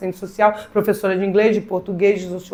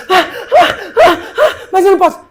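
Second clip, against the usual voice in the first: mock panicked sobbing; 10 semitones higher, 6 dB louder than usual. Student sobbing.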